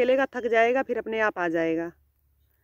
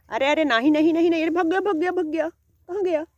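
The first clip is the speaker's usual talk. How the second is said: much higher, louder cries of alarm; alarmed cry; 8 semitones higher, 4 dB louder than usual. Real, not acted. Owner as the pet suddenly runs off, alarmed higher cries. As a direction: panic as the pet bolts away.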